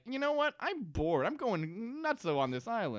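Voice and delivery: high, whiny bored complaint; bored whine. Retold or imitated. Hurley griping to Jack that he's bored, high whine. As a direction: whine like a restless kid.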